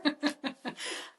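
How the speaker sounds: a quick airy laugh at herself; amused exhale of a laugh; breathy laugh. Real, not acted. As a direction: laugh it off, almost silent. Laughing at own story.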